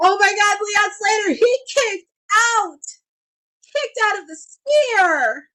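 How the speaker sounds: high, slowed, amazed cry; excited commentator cry. Real, not acted. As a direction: call the kick-out in disbelief. Live reaction to a kick-out.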